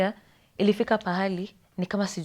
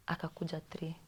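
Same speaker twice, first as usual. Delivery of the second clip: whisper; 12 dB quieter than usual. Real, not acted. Retelling nurse at 3am.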